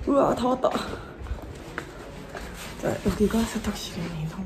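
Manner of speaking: muffled behind mask; speaking with covered mouth; through a face mask. Real, not acted. Seen 2: Speaker wears a disposable face mask over her mouth throughout.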